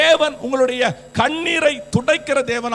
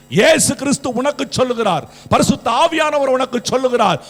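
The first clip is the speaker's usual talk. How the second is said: emphatic preaching, building louder; forceful preaching; 4 dB louder than usual. Real, not acted. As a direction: build to a ringing assurance for the congregation. Preaching at full force to congregation. (வலியுறுத்தி உரக்கப் பிரசங்கித்தல்)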